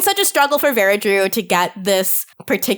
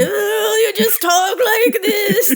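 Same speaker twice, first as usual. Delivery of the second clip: put-on high slow laughing old man; shaky old voice; 12 semitones higher, much slower than usual. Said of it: "doing the old man voice".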